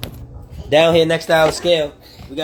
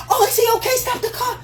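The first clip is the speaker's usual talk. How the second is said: high, worried falsetto woman; caring woman voice. Retold or imitated. Telling men what a nurturing woman sounds like, in a high worried falsetto. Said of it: "A nurturer would be".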